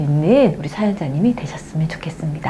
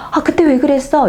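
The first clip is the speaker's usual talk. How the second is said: sharp, higher reproach; accusing tone; 7 semitones higher, 4 dB louder than usual. Shown as a demonstration. Wife blaming husband the wrong way, reproachful. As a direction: snap an accusing question. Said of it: "이런 식으로 얘기할 게 아니고".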